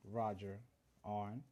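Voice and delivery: ordinary delivery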